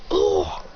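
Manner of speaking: low winded "ugh"; acted grunt of impact; gut-punch grunt. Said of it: "punches him right in the gut".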